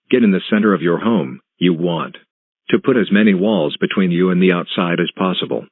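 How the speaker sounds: normal talking voice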